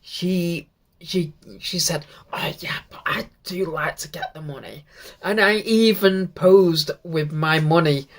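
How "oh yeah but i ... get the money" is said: soft unvoiced whisper of her joke; whispered quote. Fan quoting the wrestler's money joke in a whisper. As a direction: whisper her cheeky line.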